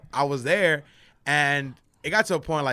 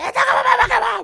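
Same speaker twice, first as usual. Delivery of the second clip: high, fast garbled shouting; hammed-up rage; 7 dB louder, faster than usual. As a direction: replay a screaming meltdown at full volume. Replays enraged outburst to dad.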